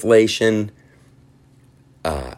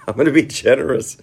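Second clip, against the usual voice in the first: laughing, higher and fast; 7 semitones higher, 3 dB louder, faster than usual. Shown as a demonstration. Host repeating his own line, laughing. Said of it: "I'm gonna, I'm gonna be generous".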